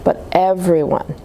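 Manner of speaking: plain reading voice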